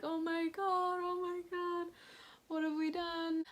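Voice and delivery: high, strained "oh my God" through tears; imitating crying. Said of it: "I just started crying".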